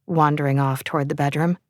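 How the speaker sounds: plain reading voice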